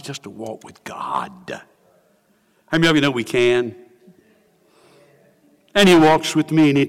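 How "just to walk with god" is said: awed breathy whisper; barely voiced, reverent. Real, not acted. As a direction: hush it as a longing wonder. Awed musing on walking with God.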